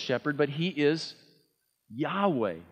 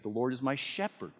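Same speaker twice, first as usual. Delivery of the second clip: "shepherd" as the weighted last word; stress on shepherd; 3 semitones lower, 9 dB quieter than usual. Shown as a demonstration. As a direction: land the emphasis on "shepherd". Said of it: "bring the emphasis to that last word".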